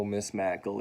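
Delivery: ordinary delivery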